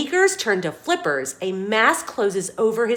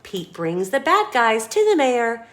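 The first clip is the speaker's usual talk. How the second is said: ordinary delivery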